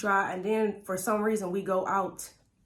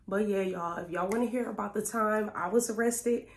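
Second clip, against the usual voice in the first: ordinary talk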